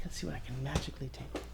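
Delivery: secretive magic-trick whisper; magician whisper. Acted. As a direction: whisper like a stage magician building suspense. Mock magic trick reveal.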